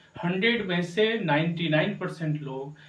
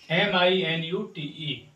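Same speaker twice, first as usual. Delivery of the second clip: spelled out letter by letter; slower than usual. Shown as a demonstration. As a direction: spell each letter out clearly. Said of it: "ये क्या है?".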